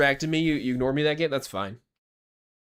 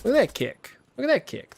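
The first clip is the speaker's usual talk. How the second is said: higher, excited repeat; hyped exclamation; 12 semitones higher than usual. Real, not acted. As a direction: cheer the kick. Hyped by a kick.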